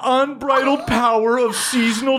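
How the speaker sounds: high, giddy bully reading a diary aloud; mocking reading aloud. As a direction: read it out high and gleeful, barely holding back laughter. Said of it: "reading a bunch of mantras and laughing".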